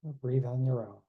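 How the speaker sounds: guided meditation. Acted. Leads closing meditation.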